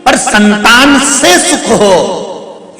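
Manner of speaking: high, slow line with से stretched out; से held out high, then a drawn-out ending; stress on से. Shown as a demonstration. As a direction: lean long on से for the contrast. Contrast hinges on added word.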